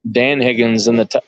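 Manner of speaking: usual speaking voice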